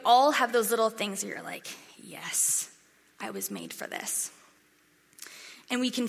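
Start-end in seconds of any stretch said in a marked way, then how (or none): 2.0-4.3 contented murmur. Retold or imitated. Quiet contentment voiced.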